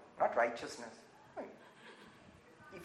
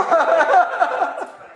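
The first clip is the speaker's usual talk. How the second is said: laughter; 4 semitones higher than usual. Real, not acted. Laughs at own joke.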